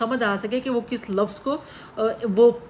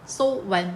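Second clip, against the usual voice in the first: "so when" as two separate, measured syllables; one foot, syllable-split. Shown as a demonstration. Teacher showing students how feet form, "so when" syllable by syllable. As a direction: count out "so" and "when" as two beats. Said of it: "देखो मैं अगर ऐसे पढ़ूँ".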